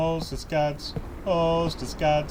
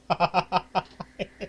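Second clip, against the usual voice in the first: nervous laugh; 3 semitones higher than usual. Real, not acted. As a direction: laugh off the scare. After the chase.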